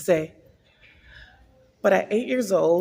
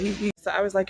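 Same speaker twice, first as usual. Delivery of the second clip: usual speaking voice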